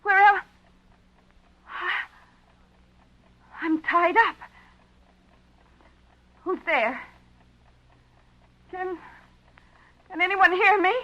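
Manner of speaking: high, scared calls into the dark; frightened calling out. Acted. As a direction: call out, terrified and lost.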